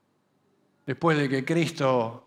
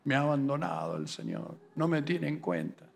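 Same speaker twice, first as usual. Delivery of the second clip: a hint of a self-pitying mumble, softer; sulky complaint; 3 semitones lower, 9 dB quieter, faster than usual. Believer complaining God forgot him, muttered softly. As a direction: grumble while kicking a stone. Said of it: "andás pateando una piedra diciendo".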